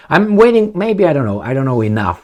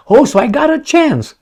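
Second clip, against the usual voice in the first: much higher, goofy voice, suddenly hopeful; dumb hopeful guy. Retold or imitated. Imitating Jim Carrey's character in Dumb and Dumber, goofy high hope. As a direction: play the clueless guy who hears he has a chance. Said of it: "He was like".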